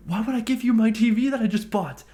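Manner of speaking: high, loud exasperated question; incredulous outburst. Retold or imitated. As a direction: burst out in disbelief at the cheek of it. Incredulous at demand for his TV.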